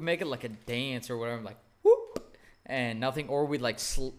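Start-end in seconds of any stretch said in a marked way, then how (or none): 1.8-2.4 playful squeal way up high; high whoop. Retold or imitated. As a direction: yelp it like a dance move. Dance while swiping cards.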